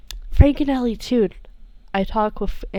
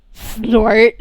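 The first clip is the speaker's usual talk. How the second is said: a sharp snort, louder; character snort; 7 dB louder than usual. Acted. Sammy snorts at Arata, a loud snort.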